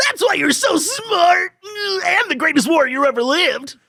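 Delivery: very high whiny anime sidekick. Acted. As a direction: squeak it out like a panicky cartoon gremlin. Sidekick voice again.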